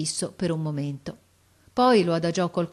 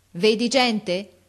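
loud, slow, raised query; called-out question; 4 semitones higher, 6 dB louder, much slower than usual. A narrator's reading. Officer questions the lookout, calling up. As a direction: stretch the question to carry.

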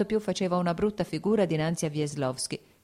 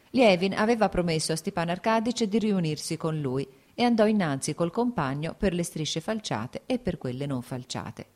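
ordinary delivery, nothing marked

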